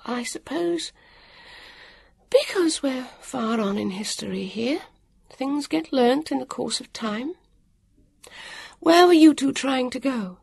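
a busy stranger explaining offhand; harassed woman of the future. A narrator's reading.

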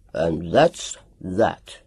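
low, very slow grunt; a low, slow final grunt. A narrator's reading. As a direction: grunt it, finished. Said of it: "grunted Doctor Willander".

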